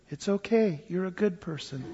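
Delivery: a soft, hushed seductive whisper; a mock tender demon murmuring reassurance. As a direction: coax gently like a sweet-talking demon. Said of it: "They sound like this".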